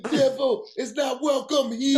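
high, loud mock Jamaican accent; a hammed-up Jamaican accent line; bad Jamaican accent. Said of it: "the worst Jamaican accent I ever heard".

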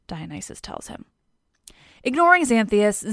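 ordinary delivery

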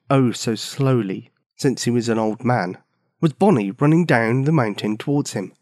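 ordinary delivery

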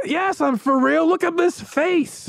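hammed-up trust-me face; mock sincere con man. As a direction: play innocent like a huckster. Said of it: "And he's like".

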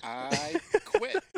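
mock quit. Real, not acted. Player jokingly quits.

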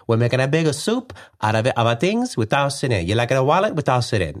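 high, lilting Italian mover voice; effeminate Italian accent. Shown as a demonstration. Husband offering items for the box, high effeminate Italian accent. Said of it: "it was more effeminate, though. It was, like, higher".

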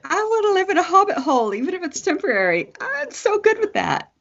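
high, fast giddy voice; bubbling over with delight; excited. Real, not acted. Dreaming aloud about hobbit hole.

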